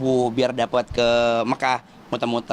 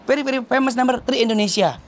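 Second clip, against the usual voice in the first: boastful claim; 10 semitones higher than usual. Retold or imitated. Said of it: "Aku langsung ngomong kan".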